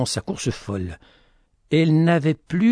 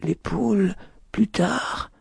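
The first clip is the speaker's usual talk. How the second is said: higher, softly wondering repeat; echoing question; 7 semitones higher, 4 dB quieter, slower than usual. A narrator's reading. Lady repeating after him, high echoing question. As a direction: echo the words back, lifting into a question. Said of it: "avait-elle repris après lui".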